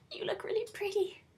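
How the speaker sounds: a hint of a timid Arizona; Arizona's line. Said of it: "all Arizona got to say is".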